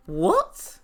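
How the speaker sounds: low start swooping up into a question; mock taken aback. Leandro reacts to Binyam's blunt question, mock taken aback. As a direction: act baffled with a rising one-word reply.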